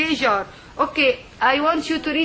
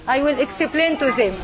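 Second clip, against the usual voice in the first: usual speaking voice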